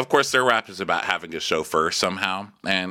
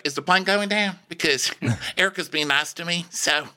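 high, hesitant housewife impression; mock-anxious higher voice; Sutton; 9 semitones higher than usual. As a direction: pitch it up, warily halting. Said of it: "And Sutton's like".

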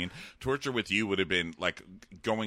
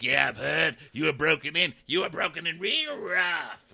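hammed-up taunt; 8 semitones higher than usual. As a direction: imitate a brute taunting, drawing out the end. Acting out the Hound's rough taunt.

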